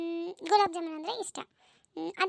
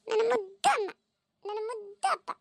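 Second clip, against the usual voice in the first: higher, sweet cooing voice; affectionate child; 4 semitones higher, 4 dB louder than usual. Acted. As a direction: coo lovingly at mum and dad. Daughter cooing at parents.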